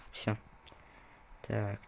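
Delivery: normal talking voice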